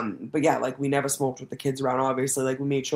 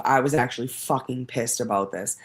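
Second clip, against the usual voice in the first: usual speaking voice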